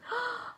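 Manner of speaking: sharp, high sharp intake of breath; frightened gasp. Acted. Frog sees the rocks.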